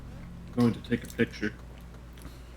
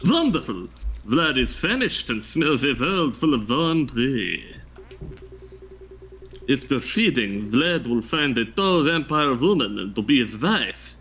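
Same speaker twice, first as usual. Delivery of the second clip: vampire voice; 5 semitones higher than usual. Pretend. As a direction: ham up a Count Dracula voice. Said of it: "give me your best vampire impression".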